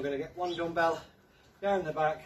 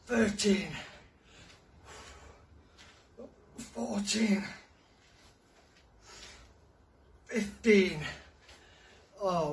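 counting workout reps; 4 semitones higher, much slower than usual. Real, not acted. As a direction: cheer them on through each rep. Coach counting reps with effort.

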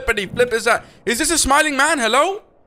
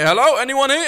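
usual speaking voice

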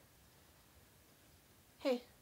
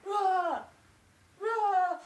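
high-pitched pretend monster roar; playful mock roar; 6 semitones higher than usual. Aunt play-fighting with her niece, high mock roar.

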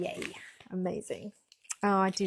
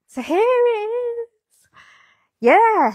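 excited reveal; 13 dB louder, much slower than usual. Real, not acted. Revealing finished sweater to camera.

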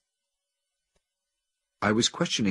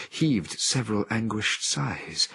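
usual speaking voice

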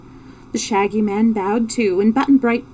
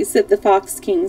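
plain reading voice

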